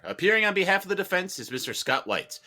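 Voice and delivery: normal talking voice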